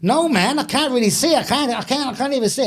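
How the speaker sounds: exaggerated Washington accent in a retold quote. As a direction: imitate a Washington man, pitched well up. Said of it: "from Washington".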